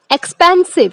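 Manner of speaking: expensive with the पेन syllable loud and high; stress on PEN. Shown as a demonstration. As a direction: lean on the middle of expensive. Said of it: "so it's पेन". (expensive में पेन पर ज़ोर)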